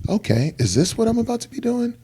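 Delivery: high, half-joking can-do reaction; mock gung-ho. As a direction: pump yourself up in a high voice. His own gung-ho inner reaction.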